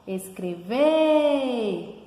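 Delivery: slow, sung-out "escreveeer"; calling-out stress. Shown as a demonstration. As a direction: call out "escrever", holding the last syllable. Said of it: "quando a gente pronuncia".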